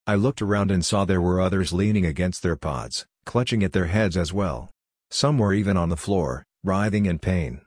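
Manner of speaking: ordinary delivery, nothing marked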